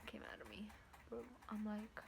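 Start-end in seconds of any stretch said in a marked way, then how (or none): 0.1-0.7 whispered confession, faster. Real, not acted. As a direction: drop to a whisper for the gross bit. Telling the gross part.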